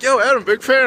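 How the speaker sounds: a hint of a friendly fan hailing you; fan greeting. Fan pulls up in his car and greets Adam, lightly voiced. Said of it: "He's like".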